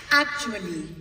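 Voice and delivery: usual speaking voice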